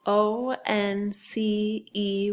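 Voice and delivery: slow, steady letter names; spelling aloud. Shown as a demonstration. Teacher leading sight word review, spelling aloud. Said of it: "and spell it".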